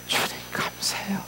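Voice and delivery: 주님 감사해요 hushed again, a little fuller; soft whispered 주님, gentle 감사해요; whispered thanks. Acted. Heart's whispered thanks to Lord.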